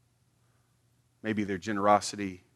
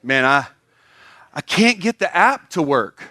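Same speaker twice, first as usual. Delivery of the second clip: higher, louder mock humblebrag; humblebragging giver; 10 semitones higher, 11 dB louder than usual. Churchgoer bragging about giving, loud mock complaint. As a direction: show off while pretending to complain. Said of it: "say stuff like".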